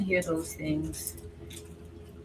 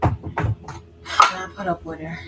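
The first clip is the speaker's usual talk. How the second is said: whispered aside; 2 semitones lower, slower than usual. Real, not acted. Aside, voice dropped.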